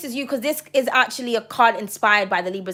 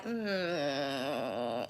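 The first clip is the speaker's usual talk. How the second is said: mock sad whimper; 11 semitones lower, 13 dB quieter than usual. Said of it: "I'm like".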